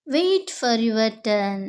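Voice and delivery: very slow, clear classroom English; slow model phrase. Shown as a demonstration. Teacher modelling the phrase for Telugu students, slow and clear. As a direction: spell it out slowly for learners. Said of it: "మీ వంతు కోసం వేచి ఉండండి".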